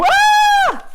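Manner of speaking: very high loud shriek; startled scream. Acted. Middle goat screaming at the troll. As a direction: shriek in fright.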